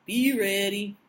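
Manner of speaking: low ominous echo. Real, not acted. Cohost echoing the Game of Thrones warning, low and ominous. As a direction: drop it down for a spooky echo.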